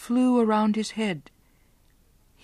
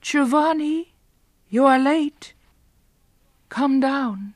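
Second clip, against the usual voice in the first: lightly voiced young woman calling, higher; sweet girl's call; 7 semitones higher, 4 dB louder than usual. Beatrice calling Giovanni down from the garden, sweetly. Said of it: "a rich, sweet voice came floating".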